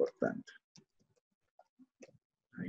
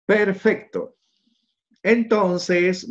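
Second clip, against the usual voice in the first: pleased exclamation; 9 semitones higher, 5 dB louder, much slower than usual. Real, not acted. Teacher pleased, moving on.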